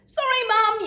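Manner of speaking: high, loud apology; yelled. A narrator's reading. Daughter rushing in after baby, loud yell. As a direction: call out sorry in a rush. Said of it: "yelled Laura".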